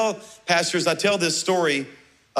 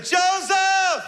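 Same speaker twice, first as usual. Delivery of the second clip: put-on calling out to the missing boy; yelling a name; 12 semitones higher than usual. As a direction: shout for a lost son. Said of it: "And I yelled".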